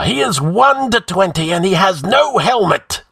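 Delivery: high yell urging on attackers; outlaw's yell. A narrator's reading. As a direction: yell it to spur the gang on. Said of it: "yelled the outlaw".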